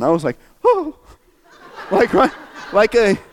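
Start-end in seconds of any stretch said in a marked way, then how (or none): 0.6-1.0 high-pitched imitated "oh" of being moved; high emotional gasp. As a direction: re-enact a sudden tender gasp. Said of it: "I went like this when the baby came on".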